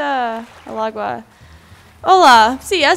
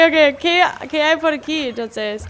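normal talking voice

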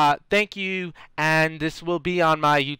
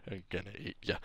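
low, hushed threat; mock menace; 8 semitones lower, 20 dB quieter, much faster than usual. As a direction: drop deep and very soft to menace your prey. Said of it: "And I say".

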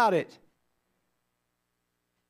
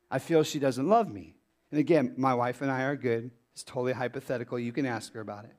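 dropped, much softer and lower aside; quiet aside; 11 semitones lower, 6 dB quieter, faster than usual. Real, not acted. Pastor to congregation, a quiet hushed aside. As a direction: slip it in under the breath. Aside disclaiming about his wife.

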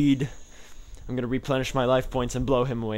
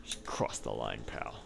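muttered taunt; 12 dB quieter than usual. Real, not acted. Taunting opponent under breath.

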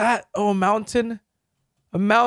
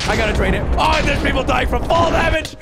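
frantic; 5 semitones higher than usual. Real, not acted. Panic as players drown.

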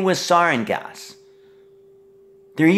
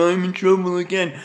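put-on mimic voice, higher; mocking quote; 4 semitones higher than usual. Mocking what the son keeps saying, in a put-on voice. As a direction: mimic the other person's whiny excuse.

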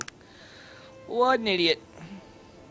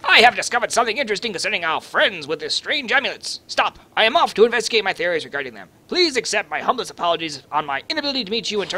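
reading the telegram fast, louder; rushed reading; 3 semitones higher, 3 dB louder, much faster than usual. Shown as a demonstration. Player rushing through a telegram in a fast, loud read. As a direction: race through the telegram text. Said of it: "Let's give it a little read".

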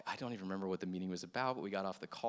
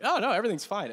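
much higher, louder, brushing it off; fake cheerful; 10 semitones higher, 11 dB louder, faster than usual. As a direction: cover the pain with brightness. Lying to pastor he's fine.

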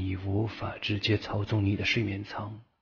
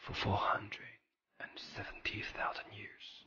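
whisper; 4 dB quieter than usual. Acted. Whispered message to sleeper.

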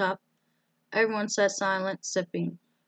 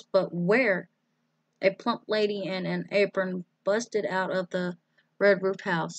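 usual speaking voice

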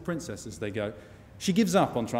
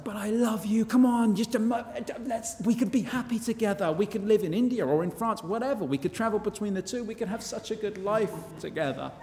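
high, pleading lovesick knight, much higher; begging her to stay, voice pitched up; pleading lover; 9 semitones higher than usual. Retold or imitated. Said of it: "But Ronaldo is like".

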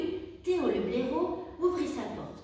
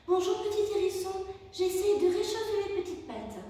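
high, louder little otter voice; 8 semitones higher, 4 dB louder than usual. A narrator's reading. Otter answering the hedgehog while warming her paws, high and chirpy. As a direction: chirp it as a small friendly otter. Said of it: "répondit Léa".

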